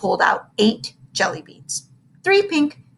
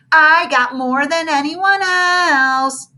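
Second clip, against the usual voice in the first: slow, louder triumphant crow; 7 semitones higher, 5 dB louder, much slower than usual. Acted. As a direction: gloat it out. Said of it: "he crowed".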